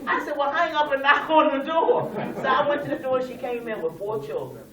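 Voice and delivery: laughing through the words, high and loud; amused teller laughing at the caller outside her own door. Real, not acted. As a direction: crack up telling the punchline.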